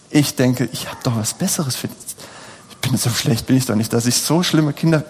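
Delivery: ordinary talk, nothing marked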